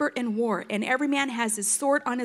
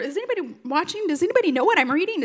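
sheepish reader; 6 semitones higher, 3 dB louder, faster than usual. Retold or imitated. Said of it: "you're like".

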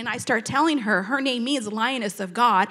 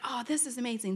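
awed hush; 8 dB quieter than usual. Retold or imitated. Retold awed reply.

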